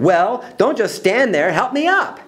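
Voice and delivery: high, climbing impatient command; bossy order. Acted. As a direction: boss the friends about, pitch soaring. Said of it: "turned to her friends and sounded".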